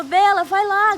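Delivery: high, loud retold yell to a friend; calling out. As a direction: holler for a friend's help. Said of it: "gritei, né?".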